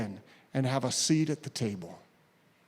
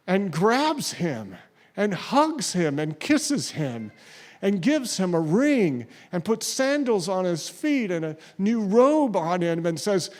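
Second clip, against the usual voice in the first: ordinary delivery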